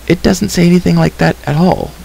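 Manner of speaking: high-pitched, mildly indignant contradiction; firm declaration. A narrator's reading. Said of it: "Elenwy declared".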